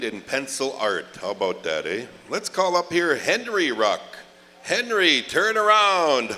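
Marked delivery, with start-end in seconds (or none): 2.3-6.3 high, loud call to the room. Real, not acted. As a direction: call him up across the hall. MC calls next person up.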